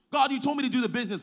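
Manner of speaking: high, rapid protest; frustrated complaint. Acted. Preacher voicing believer complaining to God, high rapid frustrated protest. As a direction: rattle off an exasperated complaint. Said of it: "we're frustrated".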